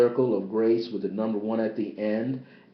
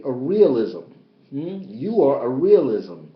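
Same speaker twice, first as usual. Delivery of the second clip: fervent spiritual preaching; impassioned sermon voice; 5 semitones higher, 5 dB louder than usual. Acted. Continued preaching.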